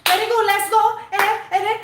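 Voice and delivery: put-on high, sing-song Spanish-accented coach praise; mocking a Spanish coach cheering his player. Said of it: "when I hear a Spanish coach go".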